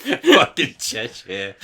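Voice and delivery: slow, laughing mock disbelief. Real, not acted. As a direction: repeat it through laughter. Mocking Greg's guess, laughing.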